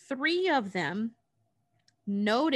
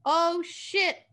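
high-pitched mock alarm; alarmed witch; 8 semitones higher, 4 dB louder than usual. Witch spots intruders in her castle, alarmed. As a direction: exclaim it as the startled witch.

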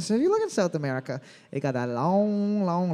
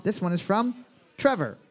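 ordinary talk, nothing marked

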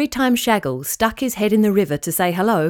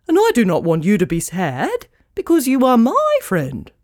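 louder, haughty but kind pelican voice; proud pelican; 3 semitones higher, 3 dB louder than usual. Acted. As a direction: speak like a grand, dignified bird. Pelican character speaks.

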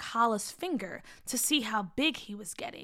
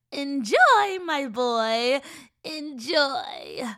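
gloating witch; 2 semitones higher, 7 dB louder, much slower than usual. Acted. Witch urges boy to eat.

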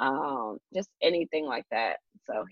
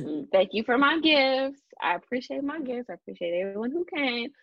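higher-pitched imitation of a polite little girl; lightly voiced child; 6 semitones higher than usual. Baby thanking party guests, lightly voiced child.

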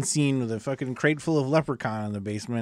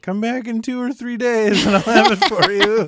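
put-on higher, louder goofy kid voice; Ozzy bargaining for time, higher and louder; imitating Ozzy; 9 semitones higher, 7 dB louder than usual. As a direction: play the kid stalling with a grin. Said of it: "I want Ozzy to be like".

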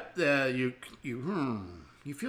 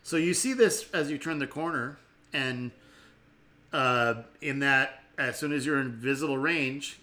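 usual speaking voice

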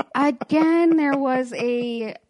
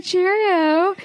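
higher, loud mock British cheerio; British accent; 8 semitones higher, 4 dB louder than usual. Drunk [name] greeting the guys at the pop machine in a British accent. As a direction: ham up a posh British greeting. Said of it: "talking in a British accent".

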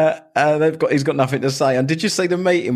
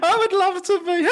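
squeaky high giggly reply; mock Kamala Harris. Host mocking Kamala accepting running mate, high giggly voice. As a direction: pitch it way up like a giggling politician. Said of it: "She went".

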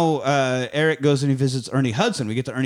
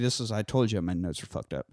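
usual speaking voice

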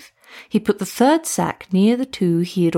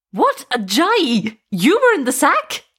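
high, louder startled cry; shocked scream; 7 semitones higher, 3 dB louder, slower than usual. A narrator's reading. Witch doctor seeing Ajayi jump from the sack, high startled scream. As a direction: shriek as the man leaps from the sack. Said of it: "the witch doctor screamed".

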